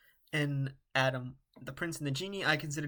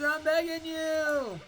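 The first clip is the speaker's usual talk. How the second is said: pleading high voice; 14 semitones higher, 4 dB louder, slower than usual. Acted. Pleading character voice.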